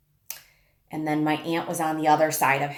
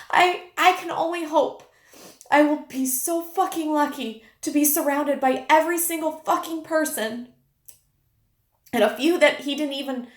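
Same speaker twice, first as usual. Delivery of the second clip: speaking through rising tears, higher; tearful high voice; 9 semitones higher than usual. Real, not acted. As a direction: voice pitched way up, close to tears. Emotional peak recalling husband's death.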